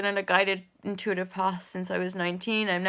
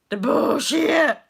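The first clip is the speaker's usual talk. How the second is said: louder, higher, slow "The bullshit."; disgusted; 5 semitones higher, 7 dB louder, slower than usual. Retold or imitated. Mocking yoga teachers, drawn-out scorn on "The bullshit.". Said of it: "I'm like".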